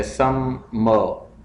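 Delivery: समर as सम, pause, मर; split into syllables. Shown as a demonstration. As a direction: break समर into two separate beats. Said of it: "syllables में divide करना है".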